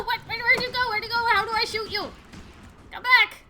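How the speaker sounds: high, loud panicked rush; frantic calling out. Real, not acted. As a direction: shout after someone getting away. Player loses target, calls out.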